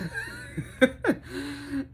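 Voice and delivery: high giddy laughter; happy laugh. Real, not acted. Laughing after the news.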